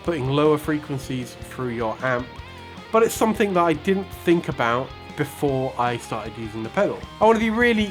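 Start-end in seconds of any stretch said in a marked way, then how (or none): none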